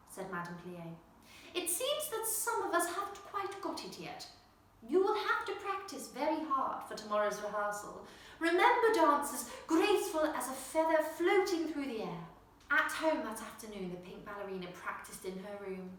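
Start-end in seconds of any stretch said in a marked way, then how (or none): 1.5-12.3 a hint of a lilting teacher, higher; rally the class gently; lightly voiced dance teacher. Teacher reminding her dancers to be graceful, lightly voiced.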